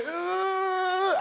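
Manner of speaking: a high whiny whimper of dismay. Retold or imitated. Dreading the workout, a high whimpering uh. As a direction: whimper like a doomed man.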